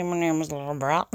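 Northern Irish, swallowing the consonants; Northern Irish accent. Shown as a demonstration. Showing off accents when bored, in Northern Irish. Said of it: "From Northern Ireland".

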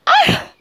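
sudden high shriek; surprised shriek. Real, not acted. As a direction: yelp as if grabbed. Flipped over suddenly.

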